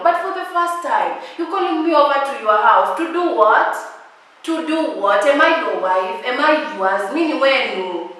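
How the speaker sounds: exasperated scolding; indignant. Real, not acted. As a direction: fire the questions at him. Indignant questions to suitor.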